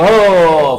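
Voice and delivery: loud, drawn-out whoop; jubilant cry. Acted. Believer getting his paper in the right hand, jubilant cry. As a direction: let out a victory cry. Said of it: "वो एक नारा लगाएगा".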